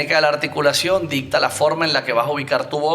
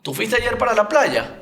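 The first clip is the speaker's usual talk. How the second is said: playa lifted much higher at the end; rising question tune; 9 semitones higher than usual. Shown as a demonstration. Coach demos question tune again, rising. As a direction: let the question climb up on playa. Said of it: "Termina arriba".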